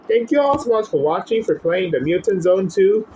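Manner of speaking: high, fast upbeat outro pitch; upbeat, fast channel sign-off; youtube outro. Acted. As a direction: sign off to viewers brightly.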